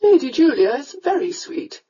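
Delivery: a mother coaxing her son to marry; countess. A narrator's reading.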